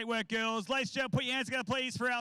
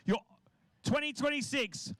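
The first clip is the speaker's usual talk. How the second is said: announcer; 3 semitones higher than usual. Acted. Announces award winners.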